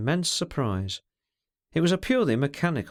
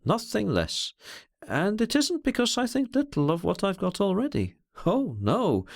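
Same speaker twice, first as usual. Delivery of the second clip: higher, lighter tone after the confidence; 7 semitones higher than usual. A narrator's reading. He drives away the thought, light tone. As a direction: shake it off with a lifted voice. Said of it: "added in a louder, light tone".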